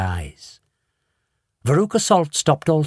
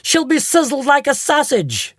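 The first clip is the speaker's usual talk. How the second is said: high stammering wail; hysterical mother; 12 semitones higher, 3 dB louder than usual. A narrator's reading. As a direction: fall apart in horror.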